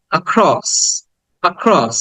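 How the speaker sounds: "অ্যাক্রস" with a very brief "আ" before stressed "ক্রস"; barely held "আ" opening "অ্যাক্রস"; quarter-length schwa. Shown as a demonstration. Teacher drilling across with students, tiny schwa then "ক্রস". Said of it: "চার ভাগের এক ভাগ".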